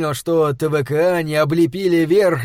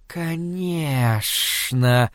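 very slow, drawn-out creature hiss; 3 dB quieter, much slower than usual. A narrator's reading. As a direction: hiss it out sinisterly, stretching the word. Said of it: "прошипел диодант".

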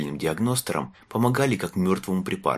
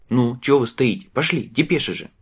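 loud, high, urging; impatient boy; 6 semitones higher, 4 dB louder than usual. A narrator's reading. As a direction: hurry him along impatiently. Impatient boy urges him on. (нетерпеливый мальчишка торопит)

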